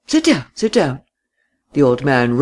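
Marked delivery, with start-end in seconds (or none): 0.1-1.0 put-on lightly raised old man's voice; fussy old host, a touch higher. A narrator's reading. Old man voiced in story.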